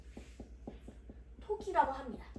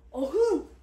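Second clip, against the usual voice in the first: loud playful growl; tiger roar; 8 dB louder, slower than usual. Pretend.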